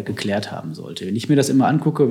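usual speaking voice

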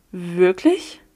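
rising, disbelieving; astonished rising Wirklich?; stunned question. A narrator's reading. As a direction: barely get the word out. Stunned disbelief after revelation.

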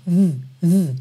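buzzed "th" sound, V written here; voiced TH. Shown as a demonstration. Teacher isolates the sound of "the", voiced. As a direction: hum the TH in V, v with voice on. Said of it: "the, é com som".

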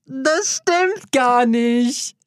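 slow, high protesting whine; drawn-out denial. Real, not acted. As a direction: stretch out the denial. Denying teasing claim.

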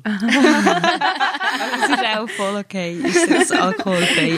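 laughing through the words. Real, not acted. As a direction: giggle while you say it. Laughing at her own mix-up.

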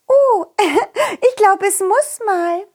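high, slow princess voice; giggling princess. Acted. Princess character voice.

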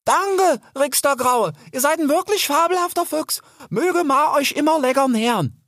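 high-pitched grateful call; high, cheery farewell call; calling back. A narrator's reading. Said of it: "noch einmal umzudrehen und zu rufen".

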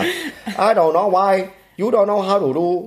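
high, slow Vietnamese-accented English. Shown as a demonstration. Comedian showing his partner the Vietnamese accent, high and slow. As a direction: stretch it out high like a Vietnamese auntie. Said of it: "Vietnamesin aus Amerika".

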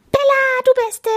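very high, loud squawk; screeching penguin. A narrator's reading. Penguin greeting his favourite playmate Bella, screeching. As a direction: squeal the greeting at full pitch. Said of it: "kreischte Maurice".

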